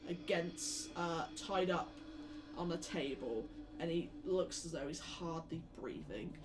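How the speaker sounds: normal talking voice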